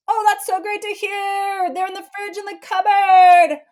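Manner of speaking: high, loud sing-song over-cheerful; mock cheerful. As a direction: brush the kid off with fake delight. Mom mock enthusiastic reply.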